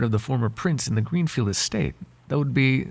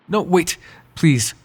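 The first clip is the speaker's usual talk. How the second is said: louder, urgent call to stop; urgent plea; 4 semitones higher, 4 dB louder, slower than usual. Acted. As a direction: call them back before they go. Begging listener not to leave.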